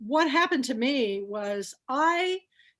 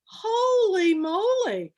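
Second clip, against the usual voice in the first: high, loud, slow holy moly; drawn-out amazed exclamation at the price; shocked; 8 semitones higher, 6 dB louder, much slower than usual. Retold or imitated. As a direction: stretch it out in disbelief. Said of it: "I was like".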